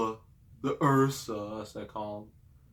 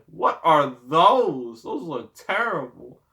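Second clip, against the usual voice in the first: mock disgust; 2 semitones higher, 5 dB louder, slower than usual. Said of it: "I'm just like".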